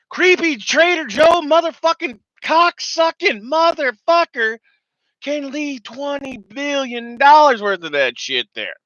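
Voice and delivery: high, loud and slow seething tirade; furious rant. Real, not acted. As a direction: rage at the government, dragging out every insult.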